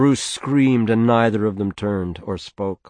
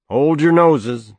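loud warning; 6 semitones higher, 7 dB louder, slower than usual. A narrator's reading. Father warns of the smell.

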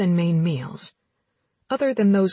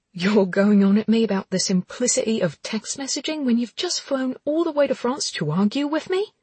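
incredulous snap back; angry disbelief; 4 semitones higher than usual. Acted. Anna voiced in dialogue.

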